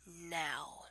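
breathy, hushed dirty-talk whisper; sultry whisper. Pretend. Reading a dirty word list, she drops to a seductive whisper. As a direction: murmur it close to the mic like pillow talk.

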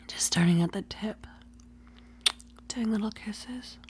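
soft teasing whisper; breathy ASMR whisper. Acted. Whispered roleplay.

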